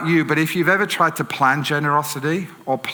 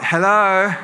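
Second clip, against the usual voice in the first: higher, louder drawn-out hello; wary friendly hello; 6 semitones higher, 5 dB louder than usual. Retold or imitated. Driver greets a stranger through the car window, higher hello. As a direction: call out a tentative greeting, pitched up.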